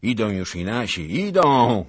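excited call building to a loud peak; exclaiming. A narrator's reading. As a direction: cry out, rising to a high shout. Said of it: "wykrzyknął pan Zagloba".

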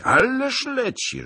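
high, drawn-out exclamation; alarmed exclamation. A narrator's reading. Zagloba spots a racing rider, startled exclamation.

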